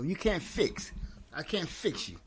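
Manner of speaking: usual speaking voice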